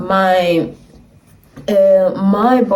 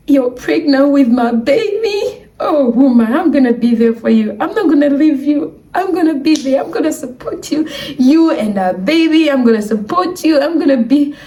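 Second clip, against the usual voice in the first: tearful promise; 6 semitones higher than usual. Retold or imitated. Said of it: "my baby was like".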